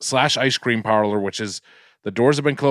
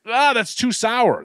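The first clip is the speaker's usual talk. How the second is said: high slow complaining mom; 10 semitones higher, slower than usual. Retold or imitated. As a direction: whine like a picky mom. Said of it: "she was like".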